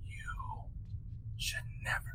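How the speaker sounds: low, unvoiced ghostly whisper; menacing whisper. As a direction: whisper like a spirit in their head. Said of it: "in a low, and menacing whisper".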